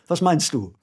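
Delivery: usual speaking voice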